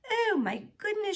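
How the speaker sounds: high, dismayed exclamation. A narrator's reading. Teacher shocked at breaking the yardstick, dismayed.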